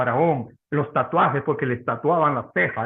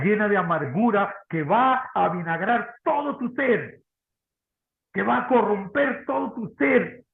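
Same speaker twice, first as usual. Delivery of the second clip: impassioned preaching; 6 semitones higher than usual. Acted. Preaching at full force.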